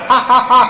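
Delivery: high, punched-out mock laugh; string of separate put-on ha's; imitated laugh. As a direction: act out two buddies cackling on cue. Speaker joins the running laugh.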